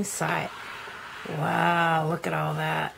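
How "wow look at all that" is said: ordinary delivery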